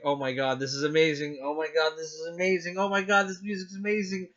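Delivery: awed reaction, climbing steadily in pitch; building excitement. Retold or imitated. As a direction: start at talking pitch and rise with each repeat. Retelling mounting excitement at trailer.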